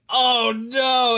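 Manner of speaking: high, loud "oh no" of sudden dismay; dismayed cry. Retold or imitated. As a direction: yelp it like you just broke your habit. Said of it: "I was like".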